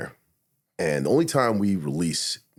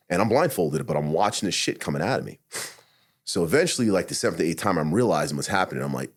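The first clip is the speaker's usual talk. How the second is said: ordinary talk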